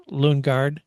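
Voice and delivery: ordinary delivery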